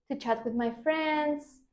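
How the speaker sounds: ordinary talk, nothing marked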